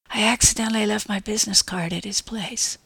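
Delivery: hushed, reluctant admission; small, whispery confession; barely audible whisper. A narrator's reading. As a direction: confess it under your breath. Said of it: "in a barely audible whisper".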